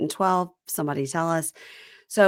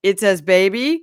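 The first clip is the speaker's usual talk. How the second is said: loud, rising realisation; mock surprise; 7 dB louder than usual. Host plays him grasping the pregnancy news, mock surprise. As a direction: repeat it louder, rising in disbelief.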